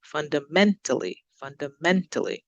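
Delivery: fundamentally with MEN lifted and punched; careful demo of funda-MEN-tally; stress on MEN. Shown as a demonstration. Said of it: "So we're going up on the, the M E N".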